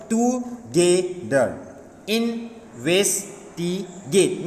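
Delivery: very slow "together, investigate", every syllable separate; to... ge... ther, in... ves... ti... gate in "together, investigate". Shown as a demonstration. Teacher showing longer words, slowly split syllable by syllable. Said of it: "means here more than two syllables".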